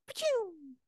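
high, soft whooshing "pachoo"; cartoon zoom noise. Showing how quickly she disappears, cartoon whoosh. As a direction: imitate a ghost zipping off, high and falling. Imitates cartoon ghost zooming away.

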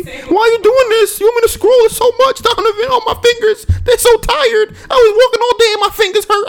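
shrieky falsetto mock wail, loud and hammed-up; whiny mocking imitation. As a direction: mimic your girlfriend whining as if scrolling were agony. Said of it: "That's how you sound".

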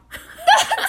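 high, loud burst of laughter. Real, not acted. Laughing about exasperating coworker.

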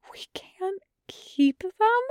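high, slow breathless whisper; shocked whisper. A narrator's reading. Chung Chung learning the puppies must go back, whispered shock. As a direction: whisper it in disbelief, drawn out. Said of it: "he asks, gasping".

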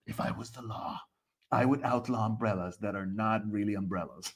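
put-on solemn authority, quiet and low; mock lawgiver. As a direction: lay down a decree in a hushed, low voice.

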